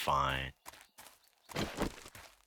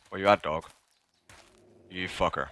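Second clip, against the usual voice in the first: ordinary talk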